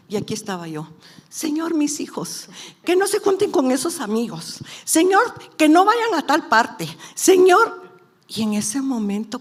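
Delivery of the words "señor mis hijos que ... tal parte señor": high, loud calls of a worried mother; frantic acted plea. Mother begging the Lord for her children, loud urgent pleading. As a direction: cry out each request, building to the last call.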